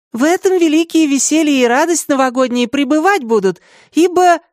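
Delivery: high, ornate courtly oration; inspired improvising. A narrator's reading. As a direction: gush a flowery speech to the tsar. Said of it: "она начала вдохновенно импровизировать". (вдохновенно, витиевато)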